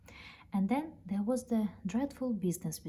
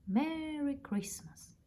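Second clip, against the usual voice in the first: slow, much higher whisper; tender farewell; 8 semitones higher, slower than usual. Acted. Moved man whispering a slow, tender Merry Christmas to the bear. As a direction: breathe it gently, moved. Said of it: "man's tearful farewell".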